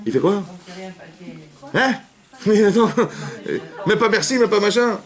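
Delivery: high indignant husband voice; offended husband. Retold or imitated. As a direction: pitch up, offended that she barely thanked him. Husband offended by wife's reaction.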